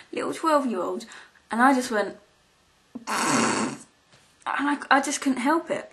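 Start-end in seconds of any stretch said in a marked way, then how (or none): 3.1-3.8 a sudden low, ugly laugh burst out; can't hold back a snort of laughter; horrible snorting laugh. Real, not acted. Laughing at the sad kid, an ugly snort of laughter. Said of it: "That was a horrible noise".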